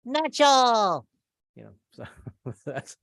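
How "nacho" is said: high, loud drawn-out call of the name; high mock call; nickname called out. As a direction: sing out the nickname. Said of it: "So now my name is".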